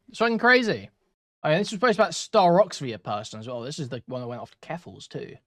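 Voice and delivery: ordinary delivery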